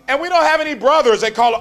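preaching emphasis. Real, not acted. Preacher scolding brothers who cancel dates, loud. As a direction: punch it at the brothers.

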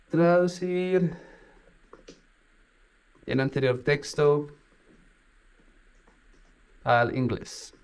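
drawn-out typing dictation; slow dictation. Shown as a demonstration. Dictating a translation request to the chatbot, slowly. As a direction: say it as you type it.

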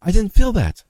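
high, loud burst of mock amazement; son's excited exclamation, retold high and loud; excited child. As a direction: imitate a child's delighted, surprised outburst. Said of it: "he would be excited".

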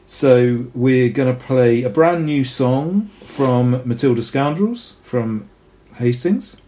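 ordinary talk, nothing marked

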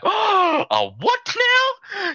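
very high, drawn-out squawk; hammed-up squeal of disbelief; mock shock. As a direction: shriek it slowly in mock outrage. Acting out shocked reaction to card.

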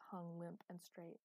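plain reading voice

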